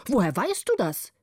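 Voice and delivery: mildly angry, startled question; understated cross old man. A narrator's reading. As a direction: demand it with a touch of temper. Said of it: "rief der Alte zornig".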